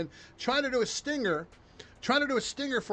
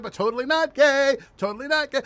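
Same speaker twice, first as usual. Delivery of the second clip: higher, louder campy mockery; sing-song denial; mock Tim Walsh; 5 semitones higher, 5 dB louder than usual. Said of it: "Tim Walsh".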